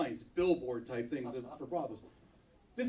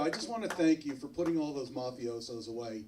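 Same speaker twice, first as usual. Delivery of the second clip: ordinary talk, nothing marked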